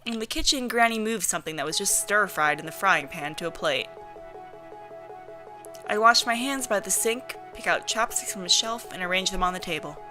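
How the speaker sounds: ordinary delivery, nothing marked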